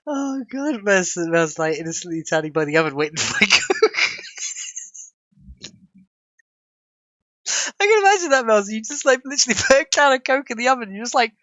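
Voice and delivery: giggling while picturing it; real laughter; laughing. Real, not acted. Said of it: "I'm crying laughing myself now".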